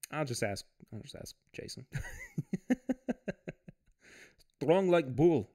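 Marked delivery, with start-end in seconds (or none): 4.6-5.5 put-on Russian accent, a bit louder; hammy Russian strongman accent; mock Russian accent. Livestreamer joking about feeling strong, mock Russian accent. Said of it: "my Russian accent".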